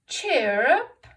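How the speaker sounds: loud, bright cheer up; expressive cheer. Shown as a demonstration. Teacher modelling an expressive line for the class. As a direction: show the class how to read with expression. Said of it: "you have a go at reading".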